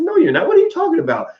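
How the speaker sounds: high-pitched mock incredulity; retold disbelief. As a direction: replay your baffled denial, voice shooting up. Retelling own disbelieving reply in quarrel.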